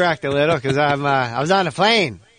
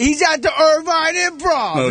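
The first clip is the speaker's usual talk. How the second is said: high Tracy Morgan voice; a high, loose Tracy Morgan; Tracy Morgan impression; 12 semitones higher, slower than usual. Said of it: "thank you, Tracy".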